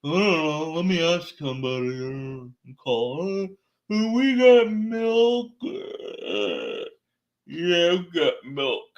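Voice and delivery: slow, drawn-out unsure clerk voice; clueless store worker. Retold or imitated. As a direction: stall like a worker who has no idea. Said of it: "Maybe they say".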